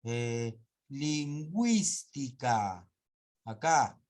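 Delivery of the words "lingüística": "lingüística" stretched, with güi as gwi; very slow word, the W glide made clear; U sounded. Shown as a demonstration. Teacher giving dieresis example, slow demonstration. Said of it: "Acá sí".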